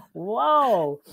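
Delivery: loud, high astonished whoa; amazed whoa. Retold or imitated. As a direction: blurt it out, floored by the news.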